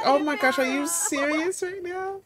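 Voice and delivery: high-pitched thrilled disbelief; amazed reaction. Acted. Friend hears about the proposal, high and amazed. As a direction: gasp it out, stunned and delighted.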